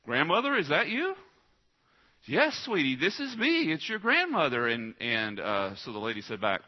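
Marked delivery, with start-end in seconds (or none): 0.0-1.1 granddaughter asking. Retold or imitated. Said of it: "And she said". 2.2-4.7 put-on high sweet old-lady voice, well above the speaker's own; a fake medium crooning as a sweet grandmother, pitched high. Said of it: "a little bit different than her regular voice".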